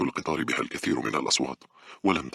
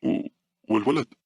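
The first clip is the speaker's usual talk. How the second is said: high, louder, startled question; fearful; 9 semitones higher, 4 dB louder than usual. A narrator's reading. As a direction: stammer out the question in fear. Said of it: "قالت بخوف".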